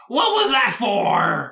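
loud, high, slow creature whine; offended little creature. Acted. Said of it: "And it turns around and is like".

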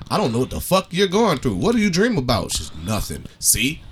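usual speaking voice

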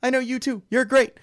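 high-pitched mock fanboy gushing; starstruck freak-out. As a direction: imitate a flustered admirer. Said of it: "he's just freaking out the whole time".

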